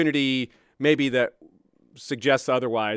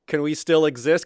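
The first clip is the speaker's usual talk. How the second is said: lightly acted, higher-pitched plea; pleading survivors; 4 semitones higher than usual. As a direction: play a meek request to be let in. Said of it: "pick up the pieces of what's left and be like".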